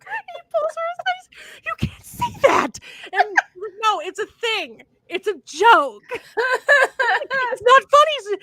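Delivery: high-pitched through laughter; can barely get it out for laughing. Real, not acted. Retelling the pastor scene while laughing, high. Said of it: "just laughing".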